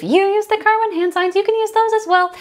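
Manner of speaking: light, high-pitched aside; high singsong. Real, not acted. Teacher reassures others about hand signs, high singsong. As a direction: say it up in a singing register.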